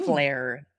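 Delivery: ordinary talk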